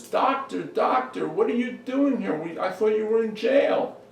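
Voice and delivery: high, excited Igor's surprise; astonished greeting. Acted. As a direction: blurt it out high, amazed to see the doctor. Igor greets doctor, startled.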